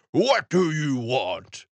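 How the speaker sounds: slow, lightly voiced frost asking; a hint of the cold spirit; understated frost question. Frost answers summons.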